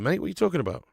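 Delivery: ordinary delivery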